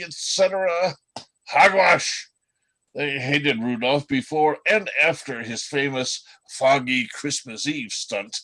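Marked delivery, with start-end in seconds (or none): 1.5-2.3 loud scoffing dismissal, a bit higher; indignant outburst. Acted. Elf scoffs at the song's happy version, loud and indignant. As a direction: bark out your scorn at the fairy tale.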